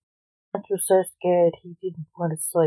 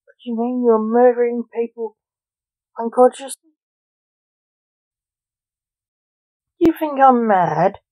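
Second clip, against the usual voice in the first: angry father, higher and louder at the end; losing it; 4 semitones higher, 4 dB louder than usual. Acted. Father loses his temper at his son, angry. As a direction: let the temper break. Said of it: "Blake lost it completely".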